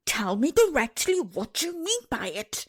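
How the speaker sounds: half wild with anger, push it up high; screamed. A narrator's reading. Nurse half wild with anger at the princess, screaming. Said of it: "screamed the nurse".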